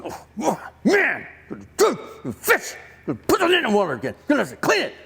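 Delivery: strained, jerky mock griping under the breath; ticked-off grumbling. Peter turns his back on Jesus, ticked-off grumbling. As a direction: act out stewing resentment in bursts. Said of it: "inside himself he's ticked".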